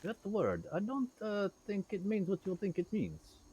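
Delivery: Inigo. Retold or imitated. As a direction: correct the villain with a smirk. Princess Bride quote voiced.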